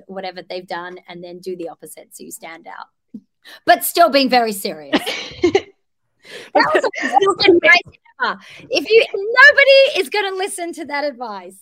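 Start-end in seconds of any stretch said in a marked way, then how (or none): 3.7-5.0 louder, laughing through the words; cracking up at her own advice. Real, not acted. 5.0-5.6 airless giggle, much higher; a burst of silent laughter; breathy laugh. Real, not acted. Laughing at her own advice. 6.5-11.6 much higher and louder, laughing; mocking her own advice mid-laugh; laughing self-mockery. Real, not acted.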